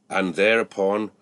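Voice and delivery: usual speaking voice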